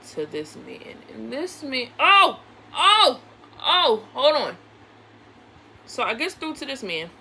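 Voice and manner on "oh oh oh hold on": loud, startled oh oh; real sudden alarm, breaking off; sudden startle. Real, not acted. Interrupting her own story, loudly startled.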